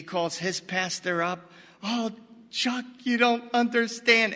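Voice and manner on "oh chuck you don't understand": mock tearful plea, higher and breaking; imitating a distraught friend on the phone, half-sobbing; retold crying. Mike calls his pastor after the money vanished, mock tearful. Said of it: "And he's crying".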